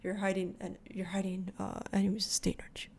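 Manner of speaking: lightly imitated deadpan, very soft; quiet retold menace with a straight face; straight-faced. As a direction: drop to a hushed, straight-faced accusation. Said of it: "immediately has this, like, straight face".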